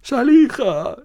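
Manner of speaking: high drawn call; summoning the spirit again. Acted. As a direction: call out her name up high. Calling out again.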